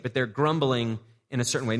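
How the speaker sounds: usual speaking voice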